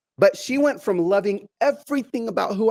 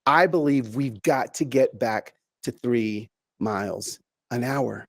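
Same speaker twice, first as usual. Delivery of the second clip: slow and measured; 6 semitones lower, 3 dB quieter, slower than usual. Shown as a demonstration. Slows down to illustrate three miles pace.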